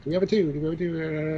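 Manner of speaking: lightly done auctioneer call; a hint of an auctioneer calling bids. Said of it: "learn how to do the auctioneer voice so I can be like".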